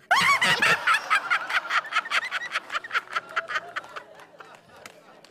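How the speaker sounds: long, high burst of laughter. Real, not acted. Husband cracking up at his own story, a long high laugh. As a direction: crack up at the punchline.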